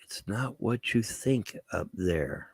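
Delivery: slow, soft, halting Shatner line; pause-laden hushed Shatner delivery; Shatner impression. Shatner warns about space in a slow hushed voice. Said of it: "William Shatner, who said".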